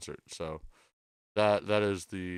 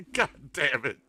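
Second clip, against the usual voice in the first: laughing; 7 semitones higher than usual. Real, not acted. Laughs at the joke.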